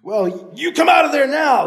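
loud, high yell. Acted. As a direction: yell the order uphill. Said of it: "the man yelled".

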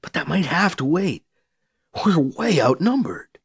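usual speaking voice

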